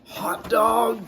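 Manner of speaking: put-on high, loud gleeful kids' shout; excited cry. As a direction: cheer like a class of freed kids. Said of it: "cried the class".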